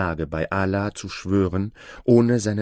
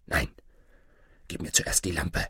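low, restrained order; commanding sorcerer; 3 semitones lower, 5 dB quieter than usual. A narrator's reading. Sorcerer demands the lamp from the boy, low firm command. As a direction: quietly insist as a controlling sorcerer. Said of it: "befahl der Zauberer".

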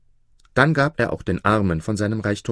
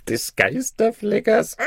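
high, slow, giggly babble; drunken laughing; 14 semitones higher than usual. Acted. Tipsy Maruf babbles nonsense at vizier, laughing drunkenly. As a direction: laugh through the words tipsily.

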